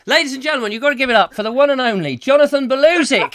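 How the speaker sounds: high, loud, rapid-fire announcer; showman's announcement. Real, not acted. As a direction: whip up applause for the star act.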